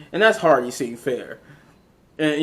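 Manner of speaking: usual speaking voice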